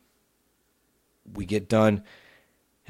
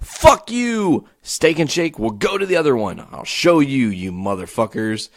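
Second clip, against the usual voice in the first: high, loud cursing that eases off; hammed-up defiant send-off to the restaurant; defiant outburst; 4 semitones higher, 3 dB louder than usual. Fed-up customer storming off to another branch, defiant.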